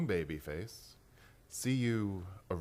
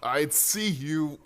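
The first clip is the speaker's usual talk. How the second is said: slow, louder gloating threat; menacing taunt; 8 semitones higher, 9 dB louder, much slower than usual. Acted. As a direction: savour each word over the victim. Killer taunts strangled victim.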